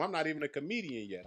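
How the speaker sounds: ordinary talk, nothing marked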